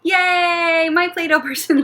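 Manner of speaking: high, loud, stretched "yay"; big celebratory yay for little kids; playful cheer. Pretend. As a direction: cheer a make-believe safe landing. Cheering the pretend landing.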